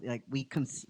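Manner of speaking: normal talking voice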